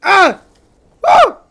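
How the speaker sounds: loud, high screams of frustration; frustrated gamer screaming; real screaming. Real, not acted. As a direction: scream it out, outraged and slowed. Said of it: "I'm just in here fucking screaming".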